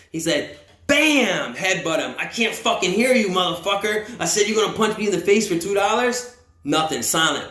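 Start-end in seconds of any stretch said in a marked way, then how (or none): 0.9-1.6 high, punchy "bam"; sound-effect blow; imitated impact. 2.2-6.4 higher-pitched mock berating; retold angry challenge, pitched up; acted angry taunt. Acts out guard berating silent man.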